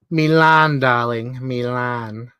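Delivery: a hint of Edna Mode; clipped diva calling darling.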